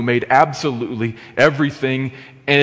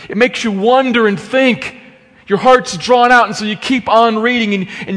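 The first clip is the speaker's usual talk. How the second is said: rising, louder preaching voice; impassioned preaching; 8 semitones higher, 6 dB louder than usual. Acted. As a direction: build the wonder of the scene, preacher-style. Preaching builds in intensity.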